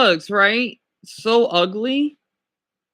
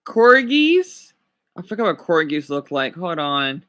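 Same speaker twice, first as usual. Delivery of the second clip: ordinary delivery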